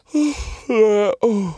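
high, cracked "oh"; lost-voice croak. Shown as a demonstration. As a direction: demonstrate a voice giving out. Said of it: "det er kult når det er sånn". (sprukken lyd uten stemme)

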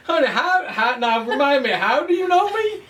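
put-on a sugary Southern accent asking sweetly; southern charm. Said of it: "uh, southern".

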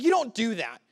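high, loud, incredulous telling-off; mock scolding. Grown man scolding his kindergarten teacher, comically outraged.

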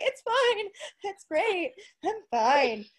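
strained high "I'm fine"; forced cheerful. Retold or imitated. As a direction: fake brightness through pain. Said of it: "I'm like".

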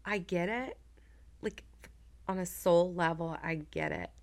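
soft, heartfelt understanding; quiet empathy. Real, not acted. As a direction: say it gently and haltingly, letting it sink in. Quietly empathizing after long pause.

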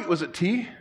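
normal talking voice

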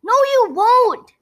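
high, loud shout back; girl shouting at whining unicorn. A narrator's reading. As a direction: cut the whining off firmly. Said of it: "Wilbur shouted".

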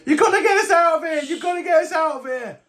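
fast, high-pitched pleading shout; frantic high cry for rescue; desperate prisoner. Acted. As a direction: beg urgently to be let out. Prisoners beg to be freed.

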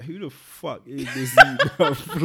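loud laughing who is this; cracking up at an old photo; laughing disbelief. Retold or imitated. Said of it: "looking at that disc like".

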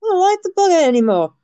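high-pitched mock Australian accent; Crocodile Dundee. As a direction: whine it in a hammed-up Aussie accent. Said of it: "I don't know why everyone has this accent".

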